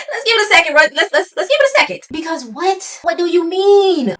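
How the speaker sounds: high, loud, incredulous pleading; flustered excited. Real, not acted. Flustered reaction to story.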